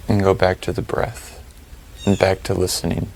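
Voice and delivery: calm, spacious delivery, letting each phrase settle; guided meditation. Real, not acted. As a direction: lead them through the breathing, unhurried and even.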